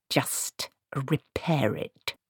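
slow, menacing whisper; hissed. A narrator's reading. Granny forcing the dwarf to fix her broom, hissed. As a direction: hiss it slowly through the breath. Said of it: "she hissed".

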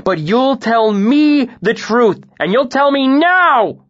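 slow, high, loud fury; furious ultimatum to the innkeeper; furious demand. A narrator's reading. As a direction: hammer each word home slowly. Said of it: "shook it furiously".

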